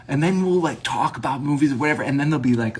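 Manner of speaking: ordinary talk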